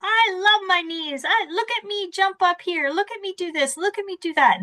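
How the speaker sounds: put-on high-pitched, sing-out kid showing off; excited little child. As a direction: play a thrilled little one begging to be watched. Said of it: "the little ones, they're like".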